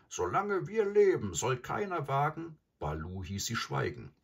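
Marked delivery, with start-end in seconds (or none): 0.1-2.5 much higher, loud furious wolf cry; angry howling cry. A narrator's reading. The four wolves protest angrily against Mowgli leaving, loud high howl. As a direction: howl the oath in fury. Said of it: "heulten wütend".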